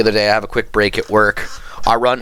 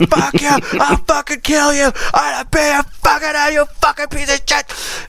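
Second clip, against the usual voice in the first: high-pitched imitation of a furious neighbor; hammed-up hysterical rage; mock screaming. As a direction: scream threats like a man losing it. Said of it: "Just screaming".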